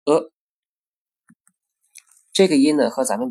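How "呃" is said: 呃 as a short relaxed u, lips barely rounded; short lax u. Shown as a demonstration. Teacher demonstrating a short lax vowel. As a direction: keep 呃 short with loose lips. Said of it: "发成".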